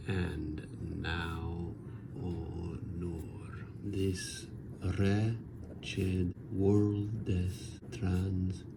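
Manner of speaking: verse read very slowly, fading down to a whisper on "honor" and "This wretched world's"; hushed verse reading. Shown as a demonstration. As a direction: let the line sink into a hushed, slow whisper. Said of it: "Chaucer's Fortune includes a French line".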